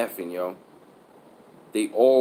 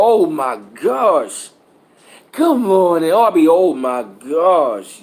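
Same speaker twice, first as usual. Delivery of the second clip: slow disgusted groan; 4 semitones higher, 7 dB louder, much slower than usual. Retold or imitated. Reacting to stinky breath.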